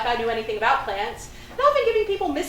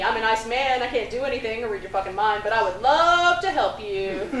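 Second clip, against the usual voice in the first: normal talking voice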